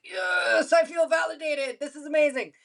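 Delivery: high-pitched gushing delight; acted-out ecstatic cheer; elated validation. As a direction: revel in the attention, voice soaring. Acts out delight at attention.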